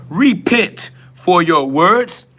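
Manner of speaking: mocking a preachy warning, pitched high; mock preacher. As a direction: parody the self-righteous commenter. Mock-reading a scolding comment.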